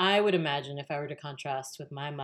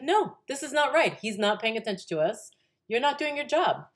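loud, high, scolding the cashier; angry mom; 8 semitones higher, 5 dB louder than usual. Retold or imitated. Mother scolding a goofing cashier, loud and high. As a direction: tell him off like an offended mother. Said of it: "it pissed her off".

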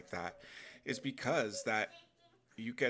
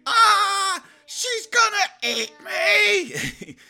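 high-pitched panicked scream, very loud; Timon freaking out; 17 dB louder, much slower than usual. Acted. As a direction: shriek it out like a hammed-up cartoon animal in terror. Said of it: "Timon was all freaking out saying".

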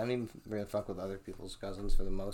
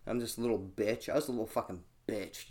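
usual speaking voice

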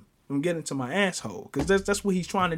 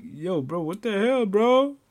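retold alarm, climbing higher and louder; scared protest; 5 semitones higher, 4 dB louder, slower than usual. Scared kid confronting the man in the arcade, retold in rising alarm. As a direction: act out a frightened protest, pitch rising to the end. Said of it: "you can hear the fear in my heart".

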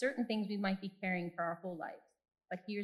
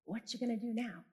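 inner voice; 2 semitones higher than usual. Retold or imitated. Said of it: "this is how it talks to me".

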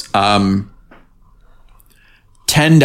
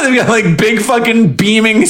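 ordinary delivery

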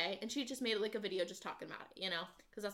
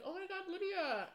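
mock gushing greeting; 10 semitones higher, 4 dB quieter than usual. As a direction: imitate someone thrilled to spot you. Acted gushing greeting from acquaintance.

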